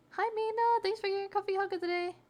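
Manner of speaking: squealy high hello to a friend in chat; excited greeting. Real, not acted. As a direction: greet her like you're thrilled she's here.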